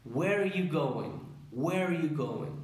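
"Where are you going?" run together, slow; linked rhythm. Shown as a demonstration. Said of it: "el ritmo del inglés".

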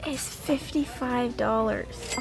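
quiet in-store aside; hushed in public. Real, not acted. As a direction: keep it down so shoppers won't hear.

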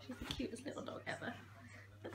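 soft unvoiced coo; whispering. Real, not acted. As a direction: whisper it fondly to the dog. Cooing at the dog.

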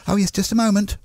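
chirpy receptionist, mock-polite. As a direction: switchboard lady cheerfully asking a caller to wait. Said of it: "operating the switchboard said".

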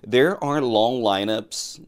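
slow, careful model sentence; slow model reading. Shown as a demonstration. Teacher modelling English slowly for students, slow and careful. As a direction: read it out slowly for learners to copy.

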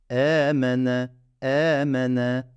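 E held out one beat; slow drawn-out letter reading; lengthened vowels. Shown as a demonstration. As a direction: stretch the E slowly. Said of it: "iki tane E attım".

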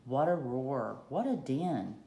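ordinary delivery

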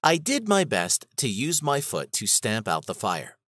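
usual speaking voice

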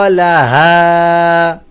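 লাহা with the final া drawn out long; slow, held লাহা; elongated vowel. Shown as a demonstration. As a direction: stretch the last vowel of লাহা. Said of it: "এই হায়ের উচ্চারণও টান হবে".

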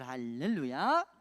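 drawn-out hallelujah, rising at the end; big voice. Shown as a demonstration. Preacher leads children in hallelujah, big voice. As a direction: call it out for the children to echo. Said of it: "큰 목소리로".

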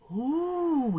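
long high swooping ooh; delighted amazement. Acted. Older brother finding buried treasure, long amazed ooh. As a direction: gasp of wonder stretched into a high "ooh".

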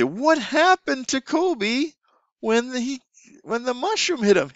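high, slow, storytelling lilt; playful teacher question. Shown as a demonstration. Teacher asking a child about Kobe in the game, high and playful. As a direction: ask it like a fun teacher. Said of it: "You say".

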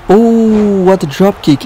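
high, drawn-out "oh" of amazement; impressed ringside call; excited exclamation. Real, not acted. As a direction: marvel at the move, pitch up. Reacting to a drop kick.

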